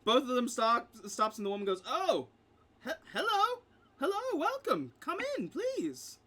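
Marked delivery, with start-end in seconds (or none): 1.9-6.2 high-pitched, startled switch to hospitality; flustered welcoming shopkeeper. Acted. Shopkeeper woman caught mid-row greets customers, flustered high welcome. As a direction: greet customers in a much higher voice.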